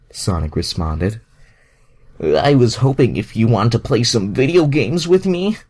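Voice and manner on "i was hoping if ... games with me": a boyish, higher, lightly voiced Iceman; a kid asking a friend to play, a touch louder; eager Bobby voice. Bobby asking Sonic to play video games, eager.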